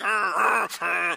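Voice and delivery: mock angry growl; growling at each other. Married couple making eye contact in the car, mock growl. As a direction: grumble like a cornered dog. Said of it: "and it's like".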